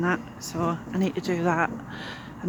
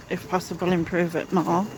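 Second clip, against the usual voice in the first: ordinary talk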